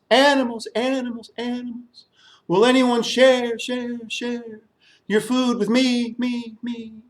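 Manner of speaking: high call out, each repeat fading away like an echo. Acted. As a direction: call across the savannah, let the echo die off. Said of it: "I called to the animals".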